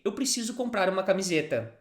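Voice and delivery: Eu preciso comprar uma camiseta run together quickly. Shown as a demonstration. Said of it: "say this a little faster".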